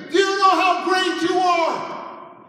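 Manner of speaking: slow, high, drawn-out scream. Retold or imitated. Cornered counselor screaming at Derek. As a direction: yell every word out long and loud. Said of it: "she's screaming".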